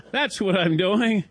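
laughing through the words; amused laugh at own fainting. Real, not acted. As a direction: chuckle while telling it.

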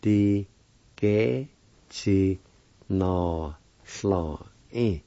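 "Di ge chi na sla in" slowly, broken by pauses; syllable-by-syllable pronunciation. Shown as a demonstration. Teacher demonstrating for learners, "Di ge chi na sla in" one syllable at a time. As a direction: sound out "Di ge chi na sla in" piece by piece. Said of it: "it's pronounced like this".

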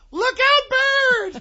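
high-pitched panicked shriek. Retold or imitated. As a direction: scream a warning in a panic. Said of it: "your voice could hit those notes".

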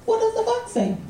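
put-on high-pitched little girl question; niece. Niece putting the big question to her uncle, high child voice. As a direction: ask it high, like a child quizzing a grown-up. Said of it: "And she goes".

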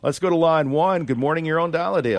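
high, loud, fast announcer call; upbeat radio host. Acted. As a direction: punch the show intro. Host announces the caller line.

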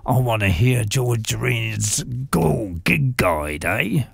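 low, slow London bloke; British accent. Acted. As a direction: put on a cheeky Brit voice. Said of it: "Bonkers Bill from Batshit Britain".

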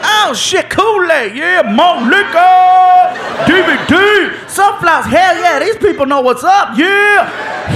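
hammed-up thrill of a man opening a gift basket; excited man. Man discovering basket items, overjoyed and shouting.

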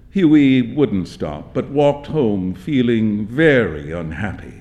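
ordinary delivery, nothing marked

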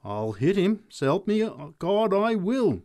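rising to high, loud outrage; indignant threat. A narrator's reading. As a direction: swear you will hit him. Big woman threatening the drunk.

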